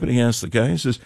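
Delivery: ordinary talk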